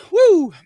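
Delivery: loud high whoop; a sudden loud, high woo. Real, not acted. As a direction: whoop to clear the air. Exclamation after cough.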